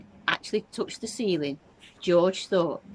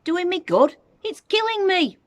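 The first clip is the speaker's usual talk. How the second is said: very high, loud shriek; screamed; 14 semitones higher, 9 dB louder than usual. A narrator's reading. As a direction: screech it in outrage. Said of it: "she screamed".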